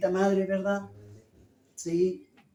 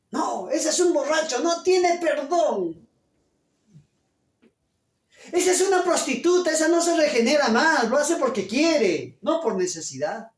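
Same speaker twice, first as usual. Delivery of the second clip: raised, scornful voice of a self-righteous churchgoer; judgmental scorn; 6 semitones higher than usual. Retold or imitated. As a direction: condemn them with contempt.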